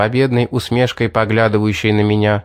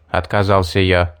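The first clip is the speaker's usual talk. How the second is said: usual speaking voice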